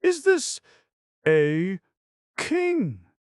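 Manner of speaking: very high, loud, drawn-out fretful squeal; stretched squeaky complaint, word by word; fretful old voice. A narrator's reading. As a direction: fuss like a cranky old gaper. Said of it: "called a fretful voice".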